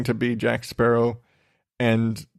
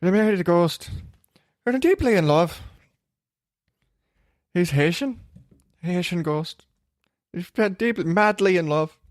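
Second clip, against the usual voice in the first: higher-pitched mock Irish voice; hazy Irish accent; 5 semitones higher than usual. Podcaster mimicking the ghost's bride, higher hazy Irish accent. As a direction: do a badly faked Irish woman gushing about her love. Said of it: "my accent's a little hazy there".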